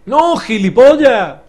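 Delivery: high, slow, loud yell at the screen; angry shout. Real, not acted. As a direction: bellow the insult, stretched out. Game piece goes wrong.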